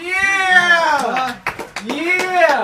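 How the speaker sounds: high victorious shout; triumphant yell. Real, not acted. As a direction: cheer your own win. Triumph after swallowing it.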